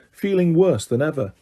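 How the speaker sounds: ordinary delivery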